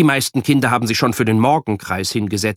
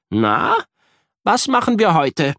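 cheerful friend; 9 semitones higher than usual. A narrator's reading. Kuno greets Jan each morning.

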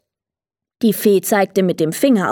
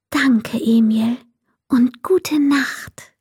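whispered goodnight; 4 semitones higher, slower than usual. A narrator's reading. Fairy whispers goodnight to sleepy Emil, slow whisper. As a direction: whisper so he won't wake.

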